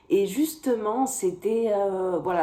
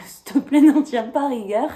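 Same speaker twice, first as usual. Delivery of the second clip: higher, louder, laughing apology; laughing plea; 6 semitones higher, 9 dB louder than usual. Real, not acted. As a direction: beg indulgence through a laugh. Laughing apology for her English accent. (supplication en riant, plus aiguë)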